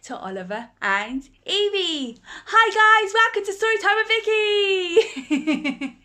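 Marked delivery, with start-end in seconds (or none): 2.5-6.0 very high, loud greeting; excited hello. Real, not acted. Host greeting Oliver and Evie, high and excited. Greeting named children enthusiastically.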